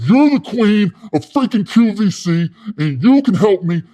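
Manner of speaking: loud, high hard-sell enthusiasm; imitating an overexcited pitchman; fired-up flattery. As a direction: sell her on it with gusto.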